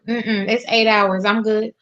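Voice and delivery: normal talking voice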